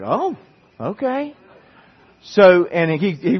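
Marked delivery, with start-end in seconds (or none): 0.0-1.3 much higher, startled oh, okay; taken aback. Retold or imitated. Pastor reacting to the professor's outburst, startled and higher. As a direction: caught off guard and backing off.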